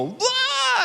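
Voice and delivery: sudden high shout of wah; mock big reveal shout. God ripping the curtains open, shouting wah. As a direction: burst out like a surprise. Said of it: "he can just rip the curtains open".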